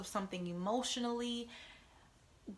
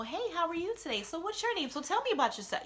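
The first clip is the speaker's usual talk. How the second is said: bright, higher small-talk greeting; chirpy rideshare opener, much higher; usual cheery greeting; 8 semitones higher, faster than usual. Retold or imitated. Rider's usual upbeat small talk with her driver. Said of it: "my normal".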